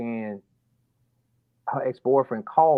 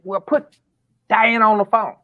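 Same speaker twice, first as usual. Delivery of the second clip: insistent order; 9 semitones higher, 6 dB louder than usual. Retold or imitated. Father insists on speaking to Diana.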